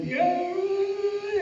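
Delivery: put-on high, drawn-out creaky old man yeah. Actor showing how casting wants old men, creaky old man. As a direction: stretch one word like a very old man. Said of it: "you wind up".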